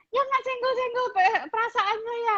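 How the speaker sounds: hammed-up child protest, climbing to a high whine; whiny complaining kid. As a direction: ham up a kid blaming mum. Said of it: "Kamu nggak bisa bilang gini".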